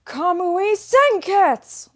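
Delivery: high, loud, slow battle cry; shouted anime transformation call; Ryuko Matoi impression. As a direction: bellow it like an anime heroine transforming. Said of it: "I can, like, kind of do a Ryuko Matoi impression".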